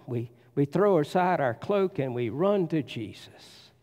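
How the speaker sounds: ordinary talk, nothing marked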